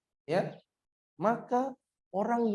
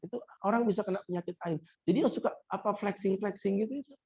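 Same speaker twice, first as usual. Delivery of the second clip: ordinary talk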